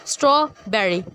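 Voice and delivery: "Strawberry" with a gap after straw; split into syllables. Shown as a demonstration. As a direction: break "strawberry" into two beats. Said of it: "word के दो हिस्से हो रहे". ("Strawberry" straw और berry में तोड़कर)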